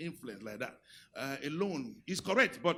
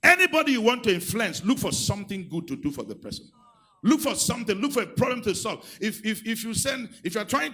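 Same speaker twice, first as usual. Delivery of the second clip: loud, higher preaching push; preaching at full force; 6 semitones higher, 11 dB louder than usual. Real, not acted. Pastor urging husbands to serve, loud preaching. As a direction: urge the congregation, nearly shouting.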